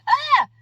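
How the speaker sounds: sudden high yelp of pain; pained cry. Retold or imitated. Head pain striking at work, sudden high pained yelp. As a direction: yelp as the sharp pain hits. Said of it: "sharp and it's like".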